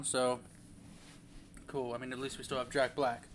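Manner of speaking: normal talking voice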